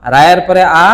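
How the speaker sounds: ordinary delivery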